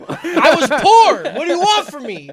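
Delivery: loud, high, laughing protest; mock-indignant self-defence; defensive outburst. Real, not acted. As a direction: plead your case at a comic shout. Teased, defends himself loudly.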